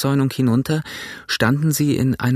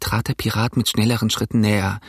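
ordinary delivery

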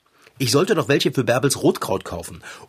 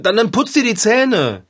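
exasperated; 8 semitones higher, 4 dB louder than usual. Acted. Owner exasperated by cat.

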